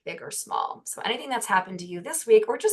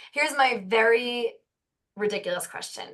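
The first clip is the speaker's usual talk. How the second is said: ordinary talk, nothing marked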